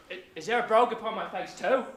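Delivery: usual speaking voice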